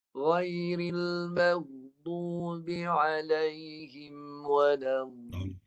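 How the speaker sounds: slow drawn-out tajwid chant; Quran recitation. Shown as a demonstration. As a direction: chant it slowly on one held breath.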